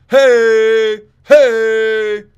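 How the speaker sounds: high, very loud repeated hey; mocking coach's shouts. Mocking the fighter's coach yelling hey from the corner. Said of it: "Marion Reneau's coach says".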